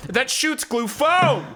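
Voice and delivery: high, drawn-out line landing hard on "foam"; stress on foam. Retold or imitated. Podcaster shows friends the vendor's inflection, heavy emphasis on "foam". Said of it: "places the emphasis on foam".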